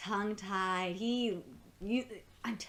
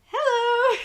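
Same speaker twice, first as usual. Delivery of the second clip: Cyril's hello; 12 semitones higher, 8 dB louder than usual. Retold or imitated. Cyril greets them.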